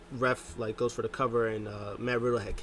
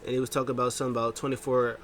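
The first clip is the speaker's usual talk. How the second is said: normal talking voice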